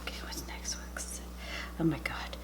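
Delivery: soft whispered aside; hushed whisper while stuffing. Real, not acted. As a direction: whisper it to yourself.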